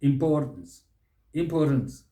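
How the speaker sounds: "importance" the American way, im-POR-tns; American pronunciation. Shown as a demonstration. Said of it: "The Americans".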